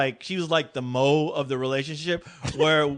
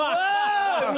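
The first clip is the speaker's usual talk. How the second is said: much higher startled cry; startled whoa; 13 semitones higher than usual. Retold or imitated. Kids startled by grandpa's teeth, high startled yelp. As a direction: yelp it like a scared kid.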